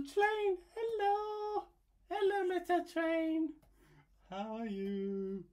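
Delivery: very high, sweet sing-song greeting; cute baby talk. Acted. Artist greeting a cute little train in a squeaky voice. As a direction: coo at the little train like a toddler.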